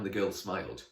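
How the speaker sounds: ordinary delivery, nothing marked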